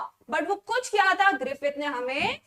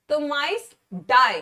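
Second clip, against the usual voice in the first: तो माइस die drawn out slowly, louder, then a slow क्यों; slow emphatic answer; 4 dB louder, slower than usual. Shown as a demonstration. Teacher stressing the result for her class, slow and emphatic. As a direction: lean on each word of the answer. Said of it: "teacher stressing the answer".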